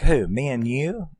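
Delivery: high, swooping mock disbelief; incredulous. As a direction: act out her disbelief, pitch climbing on the question. Retold incredulous reply to rescue plan.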